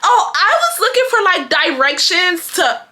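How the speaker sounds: high-pitched mock innocence; caught-out excuse. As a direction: imitate someone stalling with a made-up excuse. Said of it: "switched up her whole storyline".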